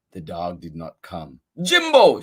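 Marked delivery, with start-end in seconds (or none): 1.6-2.2 high, loud calling shout. Acted. Charlie calls a guessed name to the dog, high shout. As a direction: call the dog across the street. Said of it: "shouted Charlie".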